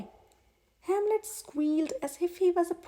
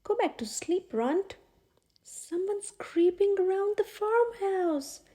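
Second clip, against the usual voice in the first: lightly voiced eager piglet, higher; a small pig whispering urgently at night; hint of a nervous young pig; 6 semitones higher than usual. A narrator's reading. Piglet wakes sleeping colonel.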